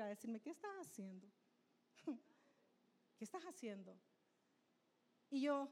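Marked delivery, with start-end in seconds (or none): 0.4-4.0 hushed, questioning voice of the Spirit; a soft, searching rebuke from within; quiet inner voice. Retold or imitated. The Holy Spirit quietly rebukes the speaker: hushed questioning.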